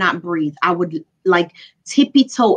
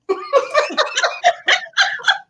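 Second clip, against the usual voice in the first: very high giggling laugh; helpless high-pitched laughter. Real, not acted. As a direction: laugh in a long high squeal. Laughs at the wedding story.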